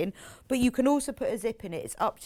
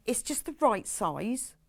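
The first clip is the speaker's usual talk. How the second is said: ordinary talk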